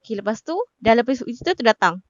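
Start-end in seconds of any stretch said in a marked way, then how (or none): none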